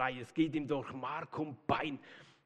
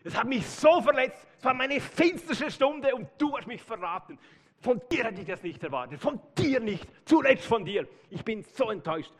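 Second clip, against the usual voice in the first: higher, louder wounded complaint; hurt lament; 8 semitones higher, 4 dB louder than usual. Pretend. Jesus as he might have scolded Petrus, hurt and reproachful. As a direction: pour out hurt and disappointment. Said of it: "Er sagt nicht".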